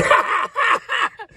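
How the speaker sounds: high shouted burst of laughter; loud high laugh. Real, not acted. Mocking himself about running, loud laugh.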